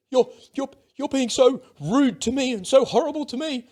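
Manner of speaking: high, loud mock crying voice; mock tearful. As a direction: imitate someone bursting into tears, high and wounded. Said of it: "like, bursting into tears".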